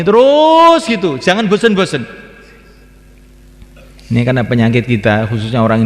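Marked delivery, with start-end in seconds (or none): 0.0-2.0 emphatic exclaim. Real, not acted. Preacher urging the listeners to keep going, ringing high. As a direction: burst up high to drive it home.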